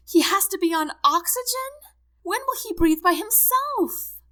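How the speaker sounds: high, loud, distraught questioning; hysterical. A narrator's reading. Wife sees husband on oxygen, hysterical. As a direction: wail the questions in panic.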